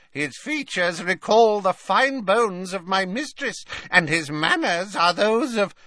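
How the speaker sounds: high-pitched, admiring croak; croaking house elf. A narrator's reading. House elf praising his favourite until cut off, in a high croak. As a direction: gush in a high creature voice. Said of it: "croaked Creature at once".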